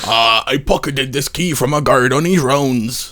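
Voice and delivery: slow strained dying voice; dying man voice. Acted. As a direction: gasp it out like a wounded sailor. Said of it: "That's much better".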